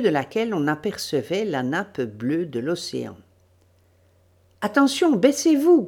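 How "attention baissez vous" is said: loud, high warning; urgent command. Acted. Dorothée warns her companions to take cover, commanding. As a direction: order them down at once. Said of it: "commanda Dorothée".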